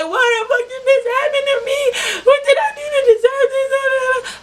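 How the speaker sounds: high, wailing half-laughing sob; mock-wail through tears and laughter; crying. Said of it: "I'm just over there crying".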